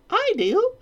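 very high, loud queen; haughty queen. Acted. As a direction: proclaim it as the queen. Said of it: "cried the queen".

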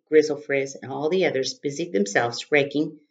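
ordinary delivery

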